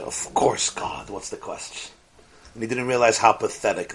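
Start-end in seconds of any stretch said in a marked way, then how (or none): 0.0-1.9 hushed breathy whisper; whispered reply. Retold or imitated. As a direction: whisper it matter-of-factly. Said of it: "And the man said".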